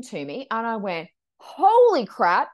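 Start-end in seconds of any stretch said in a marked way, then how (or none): 1.4-2.5 much louder, slow drawn-out amazement; stunned awe. Retold or imitated. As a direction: let the shock break open. Reading suddenly opens up.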